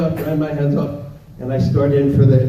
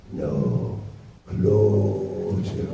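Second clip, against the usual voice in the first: put-on much lower, eerie possessed child; creepy deep kid; 7 semitones lower, 5 dB quieter, much slower than usual. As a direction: summon him like a horror-film child.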